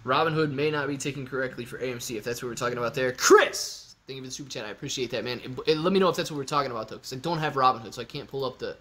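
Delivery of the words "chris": screaming a name, high and loud. Real, not acted. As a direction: yell his name out. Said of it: "I needed to scream Chris's name".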